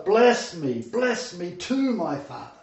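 loud, stretched pleading cry; bitter crying plea. Acted. Esau crying out to his father for a blessing, loud and bitter. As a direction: beg through the anguish, drawing out too. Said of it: "exceeding loud and bitter cry".

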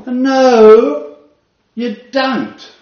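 loud, high flat denial; doctor overruling the wife; firm rebuttal. Retold or imitated. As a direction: cut her off hard. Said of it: "doctor rebuts wife".

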